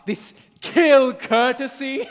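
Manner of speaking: loud laughing jeer; laughing shout. Acted. Fairy mocks sleeping lover.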